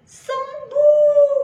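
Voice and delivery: high, loud burst of joy; joyful climax. A narrator's reading. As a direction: shout the healing out. Healed at once, climax. (seru gembira nyaring)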